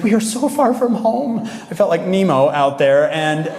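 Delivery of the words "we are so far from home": high, slow, plaintive wail; mock dismay. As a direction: wail it like a lost little creature, played for laughs. Acted dismay, far from start.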